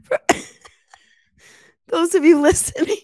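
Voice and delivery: high squealing laughter through words; can't stop laughing at the gift; cracking up mid-sentence; laughing hard. Real, not acted. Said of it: "I'm laughing".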